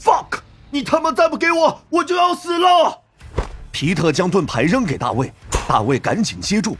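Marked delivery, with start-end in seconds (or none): 0.0-3.0 high, frantic shouted demand; desperate swearing. Acted. Fighter about to die demands the shield, desperate shout. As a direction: yell as if your life hangs on it.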